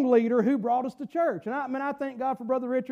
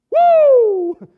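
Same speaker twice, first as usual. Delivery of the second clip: real high, loud whoop of joy; excited woo; 11 semitones higher, 13 dB louder than usual. Real, not acted. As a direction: burst out in a long "woo". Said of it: "I'm still excited".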